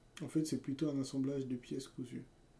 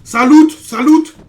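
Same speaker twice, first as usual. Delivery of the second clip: screamed high and loud, rising on loot; hype loot shout; 13 semitones higher, 29 dB louder, much slower than usual. Real, not acted. Streamer screaming over a chest full of loot. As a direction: yell it like you just hit the jackpot.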